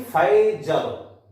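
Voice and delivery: फैजल said slowly, syllable by syllable; slow careful spelling-out. Shown as a demonstration. Teacher slowly sounds out फैजल for students. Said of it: "ला को पूरा आ के साथ नहीं बोलते".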